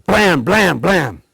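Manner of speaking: loud punchy bang noises; shotgun blasts. Acted. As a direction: blast it out like gunfire.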